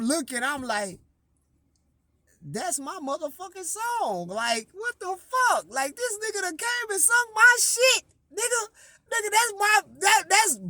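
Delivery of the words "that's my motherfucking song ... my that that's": high-pitched outrage, slowing down at the end; outraged disbelief. Retold or imitated. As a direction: sound robbed and disgusted. Retold outrage, song was stolen.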